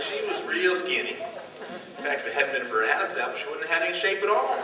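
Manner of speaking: ordinary delivery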